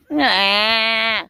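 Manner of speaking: low, drawn-out cow noise; imitated moo.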